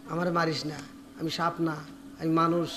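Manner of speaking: very soft, low pleading; feeble plea. Acted. Exhausted Haresa begs not to be beaten, feeble low plea. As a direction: plead weakly, barely audible.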